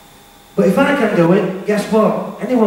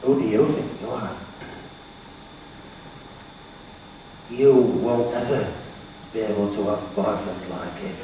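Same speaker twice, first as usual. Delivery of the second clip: low, quiet self-doubting mutter; a hint of the negative voice in your head; inner critic voice; 7 semitones lower, 12 dB quieter than usual. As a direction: voice the nagging inner critic, lower and softer. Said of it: "them two little voices".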